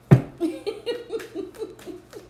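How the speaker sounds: high, airy laugh; amused laugh. Real, not acted. Reader amused midway through a reading, airy high laugh. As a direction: burst into a chuckle that tapers off.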